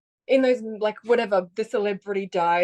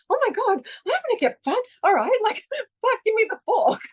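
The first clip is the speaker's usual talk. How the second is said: high, frantic and half laughing; mock panic, much higher with a laugh; panicked girl; 12 semitones higher than usual. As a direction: squeal it like a scared twelve-year-old giving in. Retelling panicked girlhood self.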